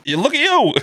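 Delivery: high, excited cheering on a diner; high-pitched, over-delighted busybody; nosy pizza-shop regular. Acted. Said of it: "I hope to one day be that guy".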